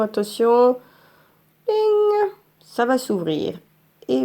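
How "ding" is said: ding imité. Pretend. Imite le son de l'appli.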